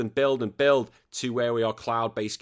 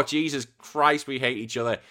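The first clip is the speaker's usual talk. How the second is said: normal talking voice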